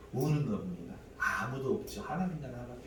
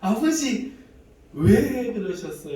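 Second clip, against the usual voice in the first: much louder, higher, slow anguished plea; loud pleading cry; 9 semitones higher, 12 dB louder, slower than usual. Retold or imitated. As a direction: cry out to the Father, slowly. Tearful plea to God.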